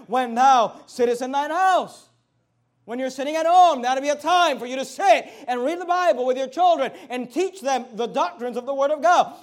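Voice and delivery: loud sermon voice pressing the point; preaching. Acted. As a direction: preach it to the parents. Sermon delivery at full force.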